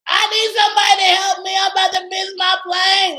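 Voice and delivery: loud, high, panicked yell for help. Retold or imitated. Frantic traveler yelling for help in the line, shouting. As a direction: yell it out over the crowd. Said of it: "So I just yell".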